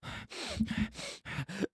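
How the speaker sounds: high, soft panting sighs; hammed-up breathy swooning; mock swooning sighs. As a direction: imitate lovestruck girls sighing heavily. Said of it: "all the girls are like".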